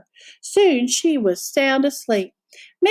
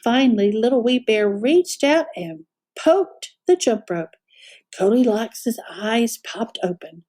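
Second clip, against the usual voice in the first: ordinary delivery, nothing marked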